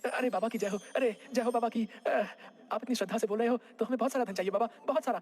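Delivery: eager, fast plea to a guru; excitable put-on devotee voice; greedy flattering villager. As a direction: fawn and beg for riches.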